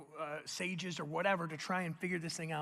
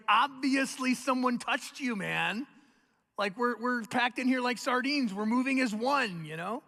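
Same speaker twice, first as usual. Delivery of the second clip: slow, loud, incredulous; exasperated Peter; 5 semitones higher, 7 dB louder than usual. Acted. Peter tells Jesus the obvious, loud and exasperated.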